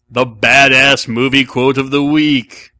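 loud, slow, drawn-out segment title; segment announcer. Pretend. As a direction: announce the feature with full booming force. Announcing a recurring show segment.

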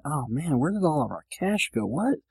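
soft, rising disbelief; acted-out soft bewilderment, pitch climbing to the end; puzzled dismay. Host replaying his money worry, puzzled dismay. Acts out money-short realization.

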